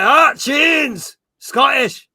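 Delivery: shouted, high-pitched Scottish accent. Shown as a demonstration. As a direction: yell it in a hammed-up Scottish accent. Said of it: "If I was gonna do Scottish".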